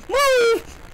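high, loud yell; shouting. Real, not acted. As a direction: yell it at the screen. Shouting at character.

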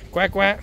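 imitating the ducks for little Sandra, playful quacking. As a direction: quack like a duck for the kid.

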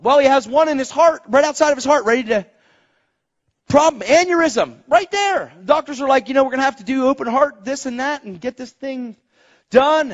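high, driving pulpit storytelling; urgent preaching. Real, not acted. Preacher recounts stepdad's aneurysm to congregation, high and urgent.

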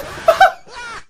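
high, loud broken scream; startled shriek. Real, not acted. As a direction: shriek in real alarm. Scare hits.

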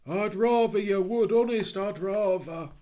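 a loud, cornered Cockney burglar. Acted. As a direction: plead like a cornered thief. Burglar pleads.

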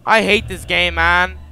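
frustrated. Real, not acted. Frustrated outburst.

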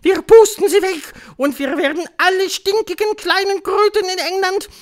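very high, loud screech; thundering witch tirade. A narrator's reading. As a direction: rant threats to rally the crowd. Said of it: "donnerte die Hoch". (donnernde Hexentirade, hoch und laut)

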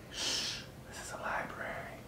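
hushed shush, then a breathy whisper; librarian shushing whisper. Pretend.

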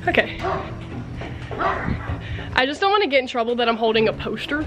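normal talking voice

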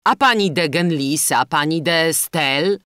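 lightly voiced indignant prosecutor; offended retort. A narrator's reading. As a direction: counter loudly, offended on a lady's behalf. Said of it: "urażony za Dinę o tę uwagę".

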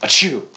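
loud sudden sneeze; real sneeze, sharp and high; sneezing. Real, not acted. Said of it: "Sorry, I had to sneeze".

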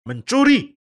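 high, loud accusing cry of thief; accusing shout. Acted. Egyptian ruler accusing the brothers, loud and high. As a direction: fling the accusation at them.

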